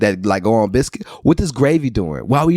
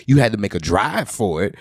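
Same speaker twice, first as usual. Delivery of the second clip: usual speaking voice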